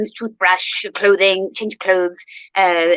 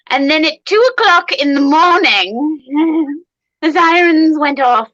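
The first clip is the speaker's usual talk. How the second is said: building excitement; 6 semitones higher, 4 dB louder, slower than usual. Real, not acted. Storytelling climax.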